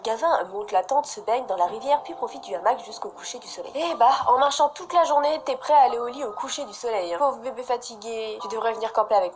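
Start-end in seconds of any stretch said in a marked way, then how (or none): none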